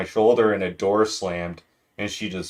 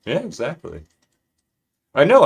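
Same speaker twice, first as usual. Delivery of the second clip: ordinary talk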